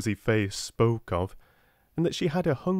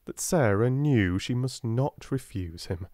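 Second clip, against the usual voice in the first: ordinary delivery, nothing marked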